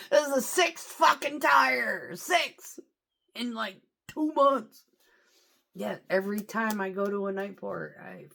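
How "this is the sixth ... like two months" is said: loud, high and slow, spitting each word out; fed up with yet another blown tire; exasperated rant. Real, not acted. Trucker to camera about his sixth flat, loud exasperated rant.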